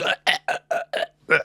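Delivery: voiceless mock death croaks; short choking grunts, playing a victim; imitated choking. Imitates old woman dying from hit.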